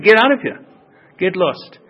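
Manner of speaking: loud, sharp shout; angry shout. Retold or imitated. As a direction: throw him out with a bark. Strict Shammai throws convert out.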